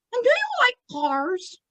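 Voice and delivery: high squeaky out-of-the-blue question; childlike ventriloquist falsetto; Tommy. Acted. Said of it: "Tommy is asking him".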